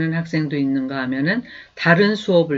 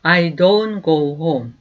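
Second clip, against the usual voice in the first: slow, clear teacher's model, each word apart; slow demo pronunciation; slow model sentence; 3 dB louder, much slower than usual. Shown as a demonstration. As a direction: say it slowly for students to repeat. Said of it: "다시 한번".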